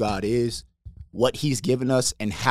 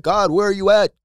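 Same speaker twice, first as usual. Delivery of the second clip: pleading complaint; 9 semitones higher, 4 dB louder than usual. Retold or imitated. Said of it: "a lot of us will be like".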